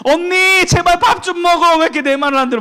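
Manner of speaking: pleading younger sister. Retold or imitated. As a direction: beg her sister to eat, exasperated. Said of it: "이렇게 하는 거예요".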